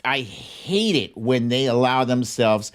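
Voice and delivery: ordinary delivery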